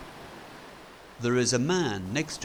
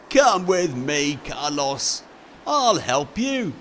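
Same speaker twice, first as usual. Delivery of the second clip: louder friendly offer; lightly voiced kind Fred; 5 semitones higher, 8 dB louder than usual. Fred inviting Carlos to the boat, louder friendly offer. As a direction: invite him along warmly.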